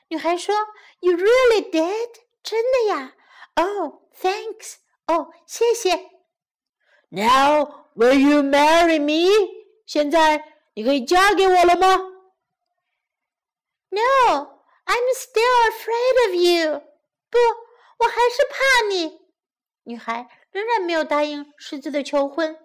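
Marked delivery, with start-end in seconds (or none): 1.0-6.1 put-on high storybook girl, pleasantly surprised. Girl thanking the lion for his effort, high and surprised. Girl reacts to lion's news. 7.1-11.9 storybook lion popping the question, louder; hopeful lion. Acted. Lion proposing to the girl, hopeful and loud. 13.9-19.2 put-on very high girl voice, protesting; fearful girl refusing. Girl turning down the lion's proposal, high and worried.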